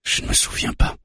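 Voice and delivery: low-energy mumble, half under breath; mumbled. A narrator's reading. Mara evades the psychologist, mumbling under her breath. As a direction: mutter it away, not wanting to answer. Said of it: "marmonne-t-elle".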